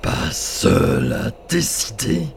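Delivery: mocking giant spirit. Spirit mocking the boy, slow with décider rising. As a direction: question it slowly with a sneer.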